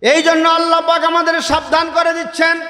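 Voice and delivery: high, impassioned sermon delivery; fiery waz preacher calling out; preaching at full force. Acted. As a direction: preach it out to the whole gathering. Preaching build-up.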